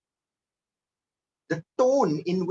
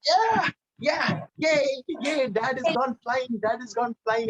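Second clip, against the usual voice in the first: put-on excited little kid cheering, higher; gleeful child's chant about granddad flying; child cheering happily at a funeral; childish glee; 5 semitones higher, slower than usual. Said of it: "the right tonality".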